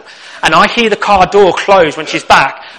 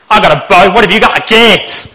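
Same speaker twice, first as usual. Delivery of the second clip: loud mock northern English warrior; northern accent; 6 dB louder than usual. Comic battle chat about weapons, northern accent. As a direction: put on a northern accent for the gate joke. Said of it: "I don't know why I went northern".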